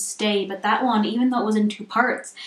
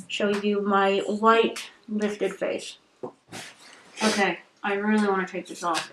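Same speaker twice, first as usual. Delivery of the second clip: through a sheet mask. Real, not acted. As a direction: mouth covered by mask. Seen 0: gold sheet mask covers whole face and mouth throughout; peeled off later.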